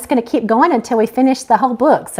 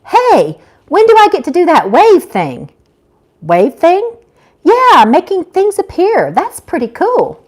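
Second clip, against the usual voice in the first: eager Gracie; 6 semitones higher, 3 dB louder than usual. Acted. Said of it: "Gertrude chuckled".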